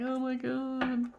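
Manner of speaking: slow, drawn-out mock exasperation; exasperated runner. Reader voices the tired runner overwhelmed by the pull, slow mock exasperation. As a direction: sigh it out like someone worn out.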